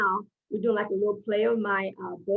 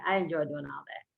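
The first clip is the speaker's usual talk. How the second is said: ordinary talk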